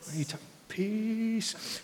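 high, soft, slow half-snapped driver remark; mock annoyed driver. Pastor mimicking himself while driving, mildly annoyed. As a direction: catch himself mid-complaint. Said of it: "I'm like".

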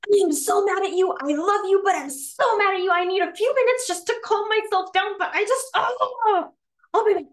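high, loud venting with love underneath; pissed off. Shown as a demonstration. Upset partner telling a loved one they need a break, pissed off. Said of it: "in the same pissed off mode, like I showed".

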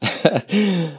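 a quick high laugh at herself; short laugh. Real, not acted. Author laughing at her cramped closet studio, a quick high laugh. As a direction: laugh it off, amused at the memory.

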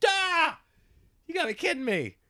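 high yelp falling into a soft, breathy "kidding me"; squeal, then sag with relief; a shrill cry of disbelief that melts away; exasperated relief. Retold or imitated. Relief at gaining an hour.

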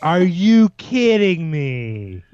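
high, slow, stretched-out disbelief; incredulous. Real, not acted. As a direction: draw out every word, appalled. Mock outrage at the restaurant's name.

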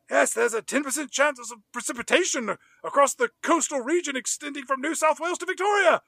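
imitating Brian's high, thrilled weather report; beside himself with excitement. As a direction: recite the forecast in giddy, high-pitched excitement. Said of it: "He is beside himself with excitement".

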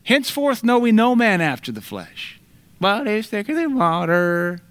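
high, mocking preachy tone; lightly voiced parody of a hypocrite; a hint of a sneering caricature. Mock sanctimonious family voice.

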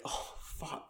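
breathless whispered curse of dread. Retold or imitated. Friend retelling a film scene, a whispered curse of dread. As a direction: hiss it under your breath in panic.

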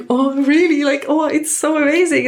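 high, delighted gasps of joy; excited midwife. Retold or imitated. Midwife thrilled to hear the head is there, high and gushing. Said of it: "she was so excited".

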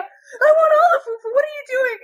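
very high, loud mock-panicked wail; old lady, panicking. Old lady horrified for her dog, high panicked wail. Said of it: "it's this old lady".

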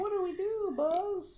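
squeaky high plea to Buzz; small toy asking helplessly, very high. Retold or imitated. A toy asking Buzz what to do, in a squeaky voice.

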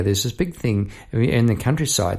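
normal talking voice

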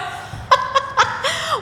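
very high burst of laughter; high shrieking laugh. Real, not acted. Laughing at the question.

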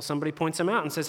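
ordinary delivery

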